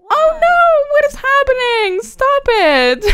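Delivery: high, loud panicked cry; alarmed shriek. Real, not acted. Player panicking as her wedding turns sour, high shriek. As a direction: squeal in horror as it goes wrong. Wedding guests start fighting.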